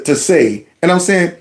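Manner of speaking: ordinary delivery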